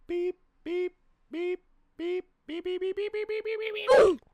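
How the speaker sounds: high, rapid beeping; very high mock horn beeps; imitated horn. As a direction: squeak out a honking car horn.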